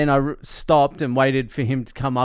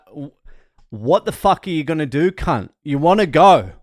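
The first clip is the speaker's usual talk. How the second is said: louder, higher challenge to the BMW driver, shouting; retold road rage yell; 3 semitones higher, 7 dB louder than usual. To the BMW driver at the lights, a loud angry retold yell. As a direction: hurl it at him like a road-rage threat. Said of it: "I'm like".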